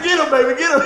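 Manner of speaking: loud laughing cheer. Real, not acted. Urging the clerk on, loud and laughing. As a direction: egg him on while laughing.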